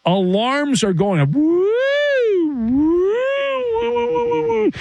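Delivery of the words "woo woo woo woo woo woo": high, wailing mock alarm siren; alarm imitation. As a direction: wail it like a ship's alarm, high and steady. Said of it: "That's weird sounding alarms".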